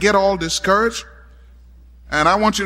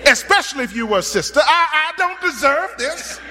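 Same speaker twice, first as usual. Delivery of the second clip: indignant mother; 8 semitones higher than usual. Retold or imitated. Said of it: "That mother could have said to herself".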